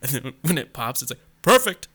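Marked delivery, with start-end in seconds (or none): 1.4-1.9 high, loud game-announcer call; Street Fighter announcer. Retold or imitated. Podcaster mimicking the sound when it pops, high announcer shout. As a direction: punch it out like a fighting-game voice clip. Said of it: "the Street Fighter sound".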